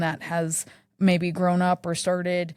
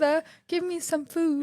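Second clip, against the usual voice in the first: put-on high, pleading British child voice; Timmy from Scrooge; 10 semitones higher than usual. Christmas Carol boy begging his father for food, mock British child voice. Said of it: "Timmy from".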